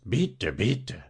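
snarled mock thanks in a put-on voice; rasping dwarf. Violinist mimics the dwarf's cursed reply, snarling rasp. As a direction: imitate the hateful little creature. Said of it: "der schnarchte wieder sein verfluchtes".

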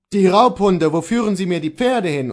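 loud, high, indignant outcry; angry protest. A narrator's reading. Beaten servant sees horses led away, loud angry protest. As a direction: protest loudly at the robbers.

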